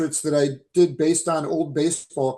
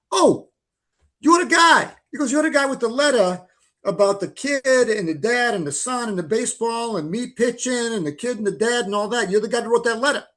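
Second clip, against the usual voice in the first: higher, excited mock Massachusetts voice for Mark; New England accent; 7 semitones higher than usual. Mark recognising the letter writer, mock New England accent. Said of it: "Fidrich accent here".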